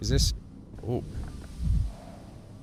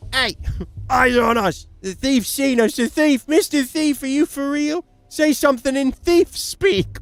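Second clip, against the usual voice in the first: voiced child; 11 semitones higher, 4 dB louder than usual. Voicing a kid character in game.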